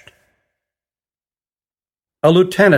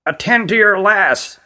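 higher-pitched, tense character voice; a terse order, pitched up with tension; tense queen; 6 semitones higher than usual. A narrator's reading. Queen telling the magician to tend his lass, tensely. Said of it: "said Lenara tensely".

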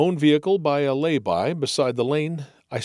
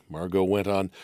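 plain reading voice

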